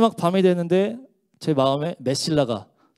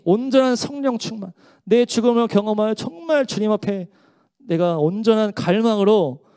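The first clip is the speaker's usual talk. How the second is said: higher, louder fervent preaching; rising preaching; 5 semitones higher, 3 dB louder than usual. Acted. Preacher urging the congregation, fervent and loud. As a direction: preach it with full force.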